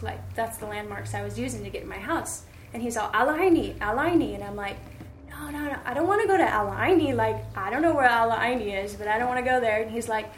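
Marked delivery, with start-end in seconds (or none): 3.1-4.3 loud, high insisting driver repeating the name; insistent taxi driver. Retold or imitated. 5.3-9.8 louder, fast frustrated refusal; exasperated protest. Retold or imitated. Retold protest to driver.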